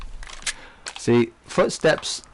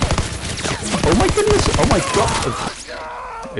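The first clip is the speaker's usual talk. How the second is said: high, slow drawn out amazement; astonished exclaim; much slower than usual. Real, not acted. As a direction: stretch it out in disbelief. Sudden surprise during gameplay.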